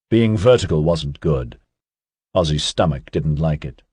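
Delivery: ordinary delivery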